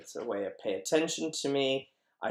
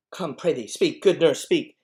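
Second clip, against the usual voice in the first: loud, high impatient pleading; 4 semitones higher, 10 dB louder than usual. Acted. Juliet begging the nurse to speak, impatient. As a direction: press hard for an answer, louder on each plea. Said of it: "Look, it's impatient. It's just impatient".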